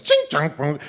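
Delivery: high, loud clanging noises; imitated clattering pans. Storyteller mimicking grandma banging pans, loud ringing clatter. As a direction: mimic pots and pans crashing.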